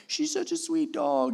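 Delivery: wife defending the dog to her husband, high and slow; mock-sweet wife. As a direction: gush over the dog the way she does. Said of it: "catching crap from Lacey".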